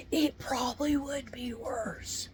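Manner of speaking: slow whispered dread; grim whisper. Real, not acted. Telling her viewers a grim truth in a slow whisper. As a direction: breathe it out like a bad omen. Grim aside after guess what.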